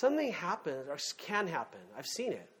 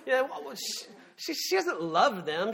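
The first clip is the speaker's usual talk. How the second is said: usual speaking voice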